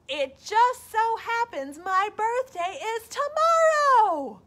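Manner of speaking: high, loud, slow call. Acted. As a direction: call it proudly to the moon. Bear calling to moon.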